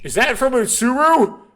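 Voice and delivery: loud, high startled question; startled shout. Acted. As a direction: jolt awake and call out. Jolted awake.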